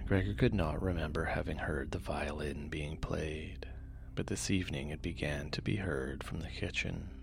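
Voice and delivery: soft, low bedtime reading; sleep story narrator. A narrator's reading. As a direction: read gently to lull listeners to sleep.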